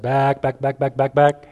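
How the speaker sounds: light impression of a sportscaster's rapid repeated call; Chris Berman impression. Preacher imitating a baseball announcer for a friend, lightly voiced announcer call. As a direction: imitate a baseball announcer tracking a long fly ball. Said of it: "Or if Chris Berman".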